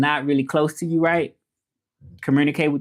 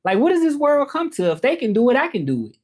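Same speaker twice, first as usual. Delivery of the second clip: high-pitched incredulous what, building indignation; mock-shocked, voice jumping up; outraged disbelief; 10 semitones higher than usual. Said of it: "I'm like".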